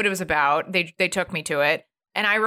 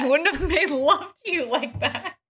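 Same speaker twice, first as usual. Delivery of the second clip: much higher, laughing through it; laughing quote; 8 semitones higher than usual. Retold or imitated. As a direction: giggle out his line. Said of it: "He's like".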